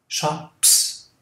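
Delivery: "shops" ending on a hissed s, no voice; voiceless s in shops. Shown as a demonstration. Teacher to students, unvoiced final s. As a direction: let "shops" end in a breathy hiss. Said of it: "O P e o S não vibram".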